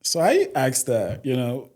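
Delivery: normal talking voice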